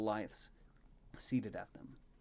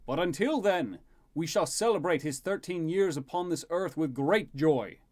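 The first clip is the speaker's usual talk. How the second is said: higher, louder ceremonial proclamation; rousing king's toast; 7 semitones higher, 9 dB louder than usual. Acted. King calling guests to toast his son, rousing proclamation.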